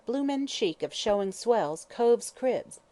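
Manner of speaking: a slow, lightly voiced London slang grumble; young man grumbles at the swells in Cockney; muttered Cockney grumble.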